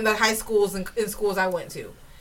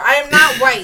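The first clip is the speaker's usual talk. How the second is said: ordinary delivery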